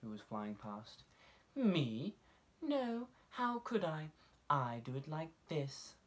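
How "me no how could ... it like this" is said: high, light dove voice, loud; airy innocent denial up high; dove answering. A narrator's reading. Dove denies it to the mole, high innocent voice. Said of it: "she answered".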